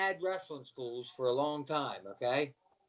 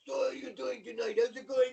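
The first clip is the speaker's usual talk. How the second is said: mock Russian accent, pitched up; Ivan Koloff; 6 semitones higher than usual. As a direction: switch into a Russian wrestling villain. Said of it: "He said, "Oh, hold on".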